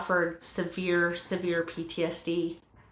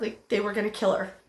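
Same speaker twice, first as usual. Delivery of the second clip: ordinary talk, nothing marked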